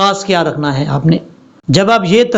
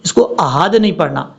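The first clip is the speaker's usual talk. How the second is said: ordinary delivery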